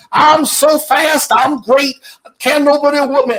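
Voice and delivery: high, loud boxing-champ brag; Ali. Retold or imitated. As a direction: crow it loudly to the whole room.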